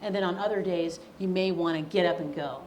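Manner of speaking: usual speaking voice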